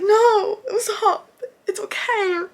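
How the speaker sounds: high, laughing-crying comfort; teary consoling. Retold or imitated. A fan comforting Usopp on screen, high and teary. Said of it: "I was, like, a mess".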